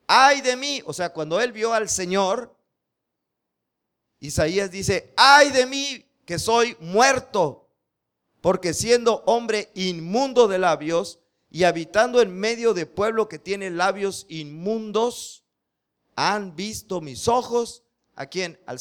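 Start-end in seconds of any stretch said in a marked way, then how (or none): 0.1-0.8 anguished cry. Acted. Isaiah's lament voiced in sermon. 5.2-17.8 loud, higher cry of woe, slowing down; anguished lament. Acted. Isaiah crying out before the Lord, loud and slow.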